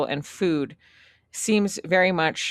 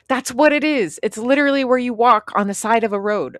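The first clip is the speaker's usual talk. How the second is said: loud, high mock exasperation; retold indignant retort; 6 semitones higher, 6 dB louder than usual. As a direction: argue back, voice raised and pitched up. Retold argument over word sidewalk.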